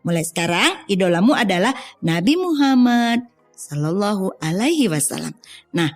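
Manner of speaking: ordinary delivery, nothing marked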